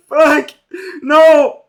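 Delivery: high, loud mock panicked yell; mock screaming. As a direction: shriek it like they're all freaking out. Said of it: "They all start screaming like".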